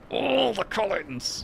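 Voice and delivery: high, slow, drawn-out cry; excited exclamation. Real, not acted. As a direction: sing it out with delight. Playful exclamation about Collins.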